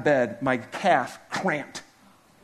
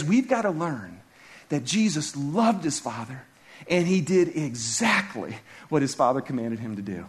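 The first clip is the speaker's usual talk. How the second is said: usual speaking voice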